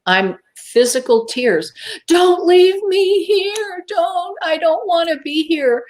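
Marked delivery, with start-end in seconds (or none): 2.1-5.8 high, slow wailing plea; acted-out crying, begging not to be left; tearful pleading. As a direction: plead in a high, drawn-out cry. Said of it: "but now I'm crying".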